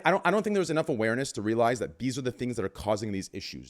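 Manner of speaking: ordinary delivery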